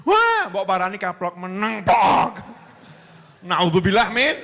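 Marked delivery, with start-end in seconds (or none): 0.1-0.5 much higher sharp outburst; angry parent. Retold or imitated. Parent scolding an older crying child, sharp high cry. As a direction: burst out at the child.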